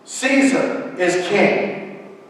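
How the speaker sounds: crowd shouting. A narrator's reading. Crowd answers Pilate again, shouting slowly. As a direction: shout it out word by word. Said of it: "the crowd again shouted".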